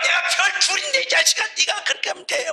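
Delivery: high, mock-indignant dad; high-pitched retold rebuke; scolding father. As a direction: imitate an exasperated general berating his son. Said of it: "하면서 혼내".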